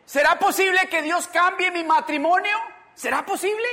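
loud, high rhetorical questions; incredulous pleading. Acted. Believers asking if God can change their family, incredulous. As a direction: cry out each question, rising at the end. Acted doubters' questions building up.

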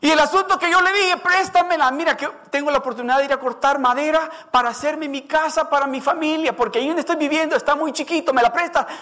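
high and fast, eager asking; hurried pleading retelling. Acted. Woodcutter recalling how he begged to borrow the axe, hurried. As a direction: rush through the favor he asked.

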